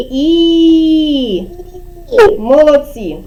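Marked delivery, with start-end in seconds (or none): 0.1-1.4 steady held І, lips spread; long vowel І. Shown as a demonstration. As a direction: draw out І evenly and long. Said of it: "давайте ще разом вимовимо його". (рівне протяжне «І»)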